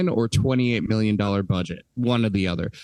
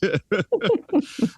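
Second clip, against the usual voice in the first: laugh; 9 semitones higher than usual. Real, not acted. Laughs at own impression.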